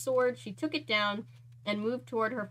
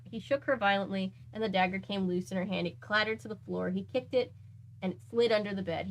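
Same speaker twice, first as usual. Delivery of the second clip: ordinary delivery, nothing marked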